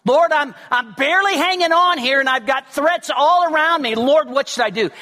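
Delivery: desperate man crying out for help; earnest cry. Retold or imitated. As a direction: call out to God in real distress. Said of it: "this sense of earnestness".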